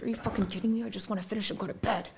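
soft, fast, fed-up muttering; muttered exasperation. Retold or imitated. Tired of his calls late at night, quietly exasperated and fast. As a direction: grumble it under your breath. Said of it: "And I'm like".

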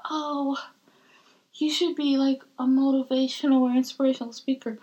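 high, eager imitation of others; lightly voiced cheerful advice-giver; mock enthusiastic people. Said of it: "people are just like".

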